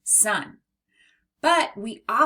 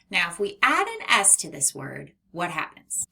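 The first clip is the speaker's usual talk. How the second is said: ordinary talk, nothing marked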